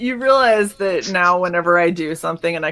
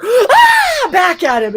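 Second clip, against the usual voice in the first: much higher, louder scream; screamed back; 13 semitones higher, 6 dB louder, slower than usual. Retold or imitated. Owner screaming back at her parrot, loud and high. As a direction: scream right back at the bird. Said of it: "I was like".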